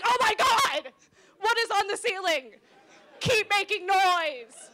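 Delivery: loud, high panicked exclaiming; frantic dog translation. Acted. Dad's words as the dog hears them, frantic shouting. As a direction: yell in alarm, dragging out the end. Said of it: "which is the same as".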